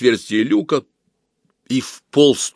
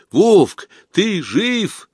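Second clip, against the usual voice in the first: calling out; 10 semitones higher, 4 dB louder, much slower than usual. Acted. Calling down the periscope.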